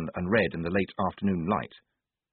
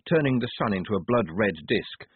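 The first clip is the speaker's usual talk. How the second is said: plain reading voice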